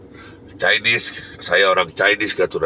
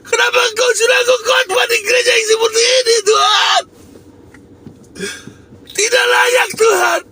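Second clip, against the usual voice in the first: crying out to God. Retold or imitated. Preacher reliving crying out to God at the empty church, sobbing shout. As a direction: wail the question at God through tears. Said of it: "Saya teriak".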